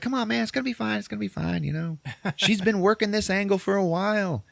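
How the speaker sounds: wheedling falsetto calm-down; high mock soothing coax. Partner calming Willie about the plan, high mock soothing coax. Retold coaxing.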